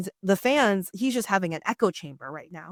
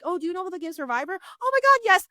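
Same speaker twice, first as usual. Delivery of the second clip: mock superfan; 10 semitones higher, faster than usual. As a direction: ham up the eager Survivor fan. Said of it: "It's like".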